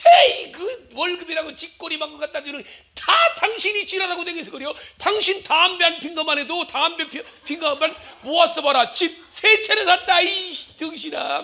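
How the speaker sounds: high scolding retort, slowing at the end; hammed-up comeback to the husband; wife scolding husband over smoking; mock angry wife.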